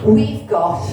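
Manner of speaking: ordinary talk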